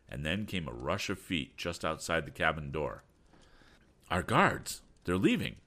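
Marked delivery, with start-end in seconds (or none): 4.1-5.6 short, raised exclamations; urgent storybook shout. A narrator's reading. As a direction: shout the news to companions. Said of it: "shouted Tom".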